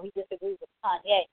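ordinary talk, nothing marked

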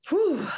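high breathy whew of relief; relieved exhale. Real, not acted. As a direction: blow out a long relieved breath. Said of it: "just to be able to catch my breath".

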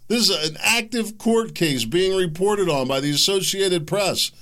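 heated rant about evil in the open; worked-up rant. Real, not acted. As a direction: rant yourself into a frenzy. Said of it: "Talked myself into a frenzy there".

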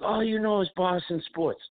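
high mocking voice of Frank. Host mimicking Frank's complaint about Boston fans, high mock voice. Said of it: "He's like".

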